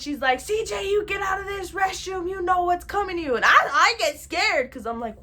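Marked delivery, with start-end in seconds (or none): 0.4-3.3 high-pitched imitation of grandma's threat; imitated angry grandma. Grandma ordering CJ out of the restroom, angry imitation. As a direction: order him out in a high scolding voice. Grandma yelling at brother in retold scene.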